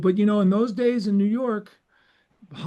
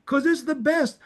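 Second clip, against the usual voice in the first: very high-pitched, insistent sales pitch; imitated lecture, high and emphatic; Walter Fields; 9 semitones higher than usual. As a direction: act out a pushy cable maker, voice pitched way up. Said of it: "He goes".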